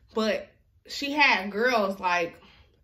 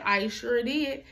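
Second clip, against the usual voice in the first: "I sure did" stretched slow and savoring, pitch back near usual; slow drawn-out repeat; much slower than usual. Shown as a demonstration. Narrator savoring the admission, slow and drawn-out. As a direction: drag the repeat out, relishing it.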